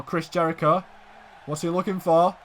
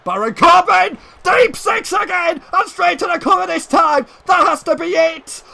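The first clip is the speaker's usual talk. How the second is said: high-pitched shouted wrestling call; excited shouting; 9 semitones higher, 4 dB louder than usual. Real, not acted. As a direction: shout it as the match-winning moment. Near-winning move and pin.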